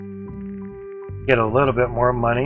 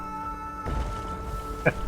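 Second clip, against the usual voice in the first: low-level, voiceless chuckle; soft laugh; 13 dB quieter than usual. Real, not acted. Player amused watching the conveyor belt, soft chuckle. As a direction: laugh quietly to yourself.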